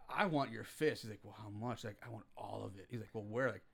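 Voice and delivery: very low, soft imitation; hushed intimate request. Acting out the film's lovers for co-host, hushed. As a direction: keep it low and nearly under your breath. Acts out hushed film dialogue.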